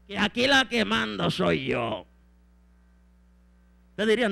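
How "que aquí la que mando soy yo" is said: bossy wife, dropped and deliberate; domineering Jezabel. Acted. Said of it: "ve a Jezabel".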